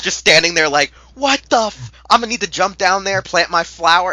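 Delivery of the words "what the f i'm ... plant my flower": high, loud, incredulous outburst; worked-up hero. Acted. Taro standing there in shock, worked-up. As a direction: rile yourself up for a fight. Voices Taro gearing up to fight.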